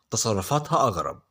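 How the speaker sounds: ordinary talk, nothing marked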